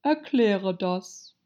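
slow, drawn-out stern command. A narrator's reading. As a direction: demand it severely, taking your time. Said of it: "sagte die Raupe streng".

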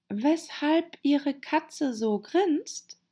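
hohe, langsame Frage; etwas schüchtern. A narrator's reading. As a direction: zaghaft und hoch nachfragen. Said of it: "begann Alice ein wenig schüchtern". (schüchtern fragend, hoch und langsam)

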